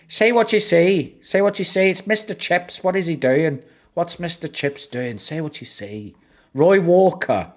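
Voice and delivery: hammy Irish host calling say what you see; bad Irish accent. Imitating Roy Walker on Catchphrase, in a bad Irish accent. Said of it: "the worst Irish accent you'll ever hear".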